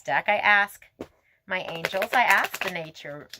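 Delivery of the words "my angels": ordinary talk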